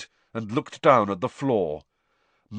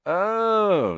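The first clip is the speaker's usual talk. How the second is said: drawn-out, louder, knowing dark "oh"; 11 semitones higher, 5 dB louder than usual. A narrator's reading. George on being asked about blackmail, darkly. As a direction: let it swell darkly. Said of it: "said George darkly".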